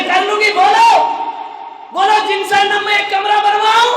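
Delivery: loud, high, eager offer; eager shout. Retold or imitated. Mother-in-law eagerly shouts to the monk that she will build a room.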